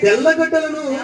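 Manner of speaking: slow, drawn-out recital of a wailed complaint; mock-lamenting scripture reading. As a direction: read it slowly, stretching each craved food as the list climbs. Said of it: "ఏమని ఏడుస్తున్నారంటే". (విలపిస్తున్నట్టు సాగదీస్తూ నెమ్మదిగా చదవడం)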